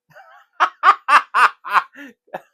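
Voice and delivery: high burst of laughter; loud laugh. Real, not acted. Laughing after impression.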